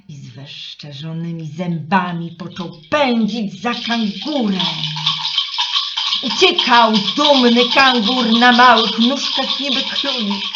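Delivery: usual speaking voice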